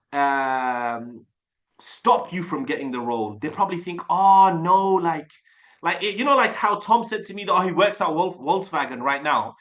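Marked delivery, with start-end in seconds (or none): 4.1-4.9 mock dismay. Imagined employer reacting to video.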